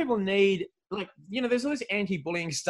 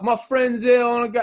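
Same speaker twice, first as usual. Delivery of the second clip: higher and louder mock whine; whiny drunk woman; 7 semitones higher, 8 dB louder than usual. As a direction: whine like a drunk fan wanting to leave. Quoting the drunk woman leaving.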